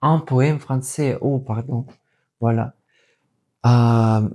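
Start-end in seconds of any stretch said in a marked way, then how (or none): none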